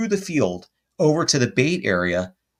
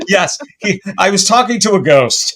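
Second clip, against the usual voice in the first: loud, high, amused exclamation; high-pitched comic outburst; hilarious outburst; 7 semitones higher, 7 dB louder than usual. Real, not acted. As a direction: blurt it loudly, playing along with the joke. Loud hilarity at own slip.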